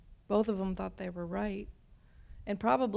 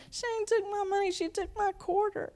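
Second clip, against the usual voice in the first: put-on very high child protesting; tattling child; 12 semitones higher than usual. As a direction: complain like an upset seven-year-old. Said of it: "My seven-year-old, she".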